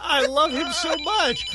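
high, slow gush. Real, not acted. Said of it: "host gushes about caller".

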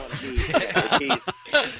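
high, drawn-out imitation of an impatient rocker; mock scream. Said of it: "you're being screamed at".

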